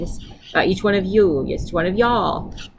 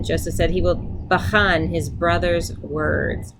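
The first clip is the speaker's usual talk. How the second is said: normal talking voice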